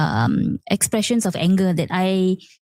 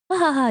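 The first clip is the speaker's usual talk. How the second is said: higher mock laughter of a child; a child's carefree giggle, lightly imitated; 5 semitones higher, faster than usual. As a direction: play the kid laughing carelessly. Child laughing, voiced by mother.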